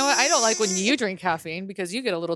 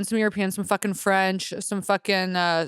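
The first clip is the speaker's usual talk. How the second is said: ordinary talk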